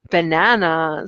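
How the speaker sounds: ordinary talk, nothing marked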